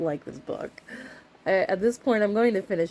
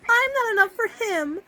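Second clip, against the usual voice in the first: much higher, mock tragic wife; husband voice then the wife echoes it much higher; lamenting wife; 3 dB louder than usual. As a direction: whimper it like a tragic heroine. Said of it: "She's now lamenting".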